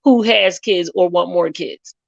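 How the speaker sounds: ordinary talk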